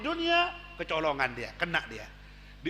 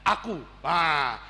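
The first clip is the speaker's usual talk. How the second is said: usual speaking voice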